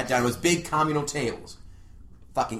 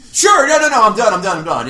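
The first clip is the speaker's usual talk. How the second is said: high, loud, panicky backpedalling; flustered backpedal; 8 semitones higher, 11 dB louder than usual. Retold or imitated. Man mock-panics when asked if he's still dating. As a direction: squeak out a quick retreat.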